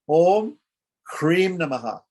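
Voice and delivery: Om sung out loud and high, then Krim Namaha; intoned mantra. Shown as a demonstration. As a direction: call out the mantra, Om ringing high. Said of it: "recites the mantra".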